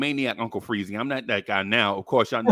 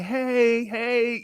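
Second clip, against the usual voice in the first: higher-pitched mock ladies' "hey"; ladies' greeting; 7 semitones higher than usual. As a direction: imitate women calling hey, pitched up. Imitates ladies greeting the men.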